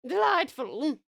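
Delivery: high, regal relish; grand mock-royal approval; playful queen. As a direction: savour it like a delighted queen. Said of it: "a meal for the queen".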